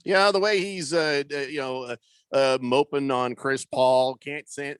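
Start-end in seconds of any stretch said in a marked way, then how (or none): none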